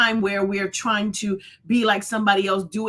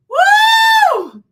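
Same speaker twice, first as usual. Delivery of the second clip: a loud, very high celebratory woo; high whoop; 11 dB louder than usual. Real, not acted. Host cheering with her viewers, a loud high whoop. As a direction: let out a big joyful whoop.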